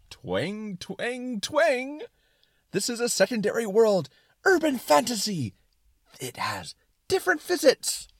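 high, loud, excited twanging cartoon voice; twang, then proclaim it at full excitement; excited suspenders. Suspenders of disbelief answering Brain, excited, high and loud. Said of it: "talk in an excited tone".

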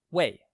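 为 hit loud with a falling tone; emphatic teaching demo of falling 为; fourth tone. Shown as a demonstration. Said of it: "W E I in the fourth tone".

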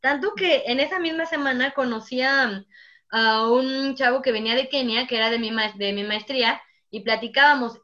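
ordinary talk

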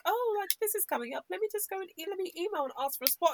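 breezy, high and carefree; casual. Retold or imitated. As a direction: toss it off like it's nothing. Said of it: "I'm so casual, like".

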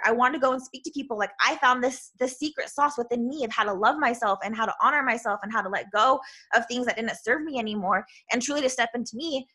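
ordinary delivery

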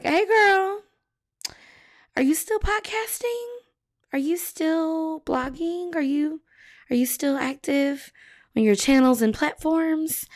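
high, sing-song imitation of folks checking in; casually prodding. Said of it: "folks casually prodding like".

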